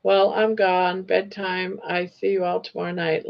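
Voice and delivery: usual speaking voice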